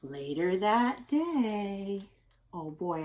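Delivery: ordinary delivery, nothing marked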